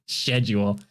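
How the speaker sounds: "schedule" slowly as SHED-jool; British pronunciation. Retold or imitated. Streamer repeating the British word, "schedule" as SHED-jool. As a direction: savor a mock-British SH at the start of "schedule".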